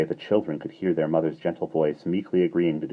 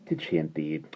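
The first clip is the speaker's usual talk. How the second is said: sympathetic murmur; 5 dB quieter than usual. A narrator's reading. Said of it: "nodding her head sympathetically".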